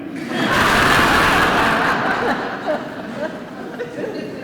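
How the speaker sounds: loud crowd laughter; the room roars with laughter; big laugh from the audience. Real, not acted.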